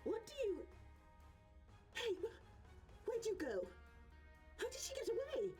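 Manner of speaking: high-pitched, startled sputtering; villain thrown by a sudden escape; flustered alarm. Acted. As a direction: break off in baffled panic.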